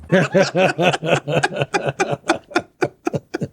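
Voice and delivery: long burst of real laughter; hearty laugh. Real, not acted. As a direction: laugh at length, really amused. Reacting to the clip just played.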